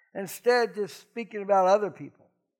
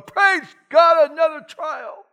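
loud, high mock-jubilant shout; mock exultation; 8 semitones higher, 8 dB louder, slower than usual. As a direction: imitate an overjoyed believer crying out. Mimics believers rejoicing over trouble.

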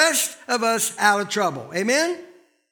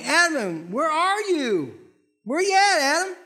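calling out; 6 semitones higher than usual. Retold or imitated. God calling Adam in garden.

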